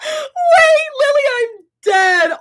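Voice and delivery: real laugh that breaks into a high shout; shrieking laughter. Real, not acted. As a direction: burst out laughing and squeal the words. Reacting to a funny comment.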